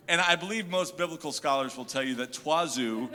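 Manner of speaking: normal talking voice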